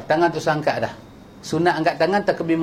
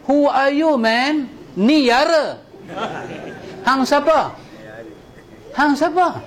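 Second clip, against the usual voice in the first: mock challenge; 8 semitones higher, slower than usual. Challenging those who forbid.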